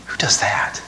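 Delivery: soft breathy sigh then hushed; whispered aside. Real, not acted. Preacher marvels at Paul rejoicing in suffering, whispered. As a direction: marvel under your breath. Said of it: "whispered sigh aside".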